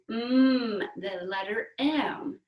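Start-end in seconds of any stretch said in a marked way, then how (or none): 0.1-0.8 drawn-out "mm" hum; M sound. Shown as a demonstration. Teacher introduces next breath, humming M. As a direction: hum on closed lips, stretched out. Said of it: "Our next sound is mm".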